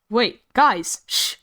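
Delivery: ordinary delivery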